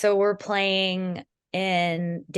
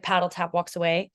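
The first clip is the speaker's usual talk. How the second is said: ordinary talk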